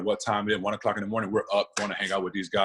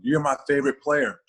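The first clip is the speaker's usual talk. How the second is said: put-on higher and louder, a kid gushing over his hero; excited kid fan; 7 semitones higher, 5 dB louder than usual. Kid at the autograph session greets the player, high eager fan voice. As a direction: play the young fan meeting his favorite player. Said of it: "some come".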